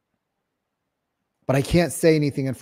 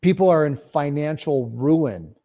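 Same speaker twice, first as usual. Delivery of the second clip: ordinary talk, nothing marked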